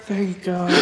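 narrated echo of the mutter; under his breath. A narrator's reading. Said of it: "underneath his breath".

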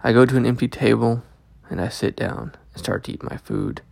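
usual speaking voice

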